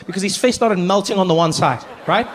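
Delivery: usual speaking voice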